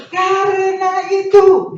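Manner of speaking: called out. Real, not acted. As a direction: call it out to the congregation. Preacher calls it out. (seruan keras dan panjang)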